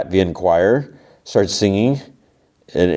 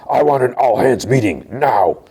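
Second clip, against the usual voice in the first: higher, strained mock roar; retold angry roar; 6 semitones higher than usual. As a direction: roar it like a furious boss, without full volume. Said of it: "he roars".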